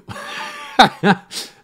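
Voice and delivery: unvoiced triumphant laugh; breathy laugh. Real, not acted. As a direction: laugh at your own bargain. Laughing at the bargain.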